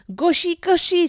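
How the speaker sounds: high, loud jeering chant; taunting insult. Acted. Children taunting the captive boy, jeering loudly. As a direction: jeer at him like a mocking child. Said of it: "sur le ton de l'insulte".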